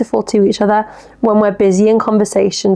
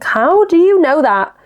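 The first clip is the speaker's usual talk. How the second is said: amazed; 7 semitones higher than usual. Retold or imitated. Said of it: "And I'm like".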